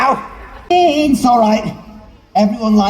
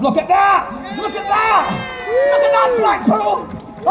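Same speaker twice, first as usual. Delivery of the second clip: excited shout; 9 semitones higher than usual. Acted. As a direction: sell the trick to the crowd. Magician hypes trick to crowd.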